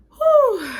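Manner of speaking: loud, high-pitched "whew"; high exhaled whew. Real, not acted. Reaction to grim news just read.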